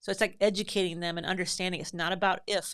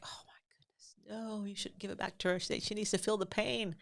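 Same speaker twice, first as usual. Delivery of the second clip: half-whispered, incredulous aside; hushed exasperation; 3 semitones higher, 6 dB quieter than usual. Retold or imitated. Answering parents' comments in a hushed, exasperated whisper. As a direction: drop to a hush, amazed anyone would give in. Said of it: "I'm just like".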